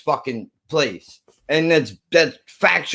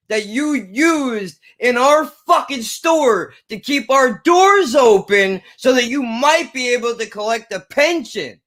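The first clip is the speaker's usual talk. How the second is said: mock outraged boss; 7 semitones higher than usual. Boss's suspension retold as mock rant.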